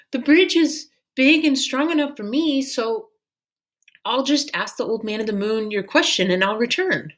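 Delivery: Minley offering to go alone; girl's voice. A narrator's reading. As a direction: keep it light and brave. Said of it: "Minley said".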